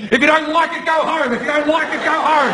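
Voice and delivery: high repeated jeering chant; mock angry heckler. Angry audience member telling the foreigner to go home, mock chant. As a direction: chant it like an angry nativist on loop. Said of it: "And you're just going".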